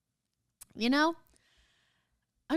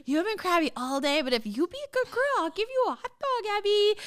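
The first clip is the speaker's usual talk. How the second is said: rising, higher-pitched coaxing reprimand; mock parental bargaining; 9 semitones higher than usual. Mom bargaining with crabby Abby over a hot dog, mock coaxing. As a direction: climb higher as you dangle the reward. Scolding and bribing Abby like child.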